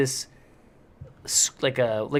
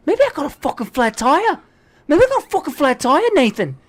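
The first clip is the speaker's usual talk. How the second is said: raised, loud mock exasperation in Australian accent; angry Australian; 11 semitones higher, 5 dB louder than usual. Dad to Nathan about a flat tire, mock Australian anger. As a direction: imitate an Australian driver getting more worked up. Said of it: "he's Australian. He's like".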